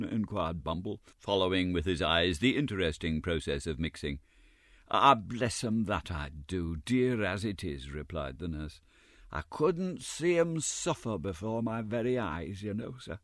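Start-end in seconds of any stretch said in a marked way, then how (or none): 4.9-13.2 soft sanctimonious excuse; pious Mrs Mann. A narrator's reading. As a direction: sound tender about the children.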